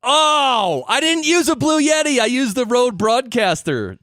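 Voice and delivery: high, loud "oh no" shout; surprised outcry. Real, not acted. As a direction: cry out in dismay at the mistake. Corrects a wrong sponsor claim.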